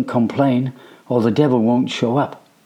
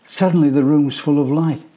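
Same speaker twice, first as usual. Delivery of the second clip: ordinary delivery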